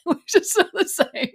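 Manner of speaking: real amusement bubbling through the words; laughing. Real, not acted. Recapper sharing a meme about lookalike contestants, laughing.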